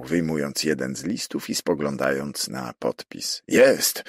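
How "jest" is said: higher, louder cry of discovery; exclamation. A narrator's reading. Prefect confirming the signature, exclaiming. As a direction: exclaim it, short and raised. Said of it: "zawołał".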